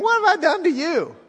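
donkey voice. Acted. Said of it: "with the donkey saying".

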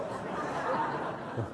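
airless chuckle; silent breathy laugh. Real, not acted. Preacher laughing at his Rembrandt joke. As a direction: laugh under your breath.

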